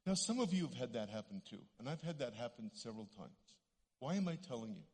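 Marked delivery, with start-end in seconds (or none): none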